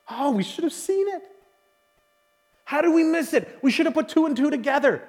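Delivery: oh, how did we miss it, said in dismay; rueful realization. Retold or imitated. The writer John thinking aloud, a touch of dismay. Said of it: "he's thinking".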